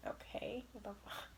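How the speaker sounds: under-the-breath whisper of disbelief; whispered aside. Retold or imitated. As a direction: mutter it so they can't hear. Her muttered reaction to insult.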